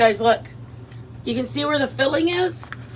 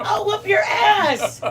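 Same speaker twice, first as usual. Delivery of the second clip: mock threat; 8 semitones higher, much slower than usual.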